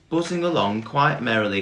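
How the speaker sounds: usual speaking voice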